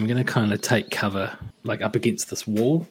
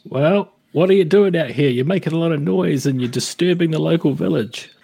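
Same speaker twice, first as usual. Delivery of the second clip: calling back; 5 semitones higher, 5 dB louder than usual. Acted. Lovejoy calls back to Bargle.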